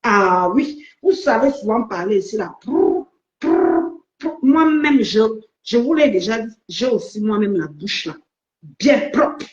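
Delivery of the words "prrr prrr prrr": scornful raspberry lip trill, high; mocking lip trill. Real, not acted. Mocking a rival's chatter with a high lip trill. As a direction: blow a dismissive lip trill.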